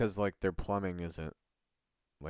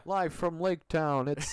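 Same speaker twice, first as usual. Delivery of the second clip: TV announcer; 9 semitones higher, 6 dB louder, much slower than usual. Pretend. Mock late night show intro.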